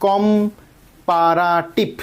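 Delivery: slow, drawn-out syllables in কম্পারাটিভ. Shown as a demonstration. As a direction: break কম্পারাটিভ into separate, stretched syllables. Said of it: "অনেকবার পড়তে হচ্ছে". (কম্পারাটিভ ধীরে, syllable ভেঙে ভেঙে)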